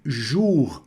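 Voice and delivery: "jour" as a stretched French "zhoor"; syllable pronunciation demo. Shown as a demonstration. Teacher points at the second syllable, syllable pronunciation demo. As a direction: draw out "jour" slowly with an oo vowel. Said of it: "da pronúncia desse bonjour".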